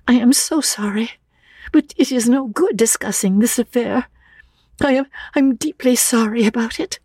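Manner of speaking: put-on high, apologetic young lady; high-pitched, flustered apology; distressed young woman. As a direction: close the subject in a high, anxious voice. Narrator voices Lucy's dialogue.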